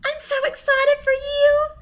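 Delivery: high, loud cheerful squeal; excited. Acted. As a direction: gush with excitement. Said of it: "giddy and cheerful".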